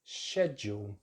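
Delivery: "Schedule" starting like shed; British "Schedule" as SHED-yool; British pronunciation. Shown as a demonstration. Teacher links it to garden shed, "Schedule" as SHED-yool. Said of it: "correct British English pronunciation is".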